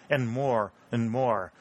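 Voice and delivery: usual speaking voice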